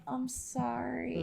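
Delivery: imitating a small, drawn-out sorry; sheepish apology. Imagined sheepish apology from Jillian.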